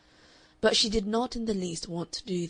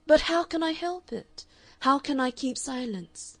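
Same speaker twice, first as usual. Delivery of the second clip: desperate plea; 7 semitones higher, 5 dB louder than usual. A narrator's reading. He pleads desperately.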